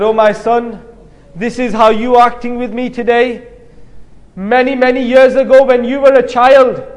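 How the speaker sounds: ordinary talk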